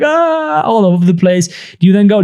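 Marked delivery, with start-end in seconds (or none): 0.0-0.6 put-on child's wail. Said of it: "They're like".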